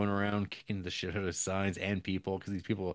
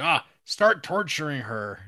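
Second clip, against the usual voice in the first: loud, higher offhand command; louder, higher callous boss; careless villain order; 7 semitones higher, 8 dB louder than usual. Retold or imitated. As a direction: toss out the order like a bored tyrant.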